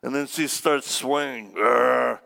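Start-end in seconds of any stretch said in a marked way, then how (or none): 1.5-2.2 loud imitated growl; mock possessed growl. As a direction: let out a hard, sustained growl. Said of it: "starts growling".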